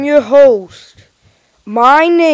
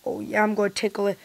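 ordinary talk, nothing marked

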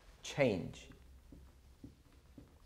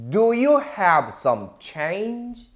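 slow, stretched model reading; slow careful reading; 3 semitones higher, much slower than usual. Shown as a demonstration. Teacher reads the sentence slowly for students to copy. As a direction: read it slowly word by word. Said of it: "발음 한 번 더 읽어볼게요".

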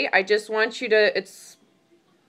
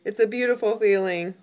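ordinary talk

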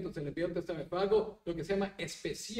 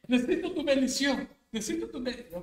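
retold shout; 8 semitones higher, 8 dB louder than usual.

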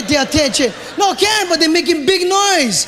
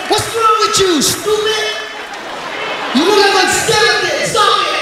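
high, exasperated mock scolding; hammed-up intervention yell; 6 semitones higher than usual. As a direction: tell them off in a raised, high voice. Acted scolding of addict.

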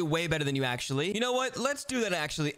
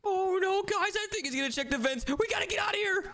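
high, urgent pretend alarm; hammed-up escape panic; mock panic; 14 semitones higher than usual. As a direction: warn your audience in a high-pitched fluster. Seeker about to check the vents.

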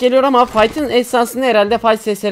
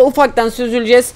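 usual speaking voice

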